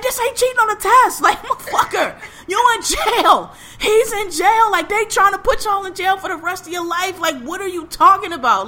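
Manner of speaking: high, exasperated mock rant; frustrated mom. Mom telling son jail is serious, frustrated. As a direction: imitate a mother finally losing patience, pitch way up. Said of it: "she finally got frustrated".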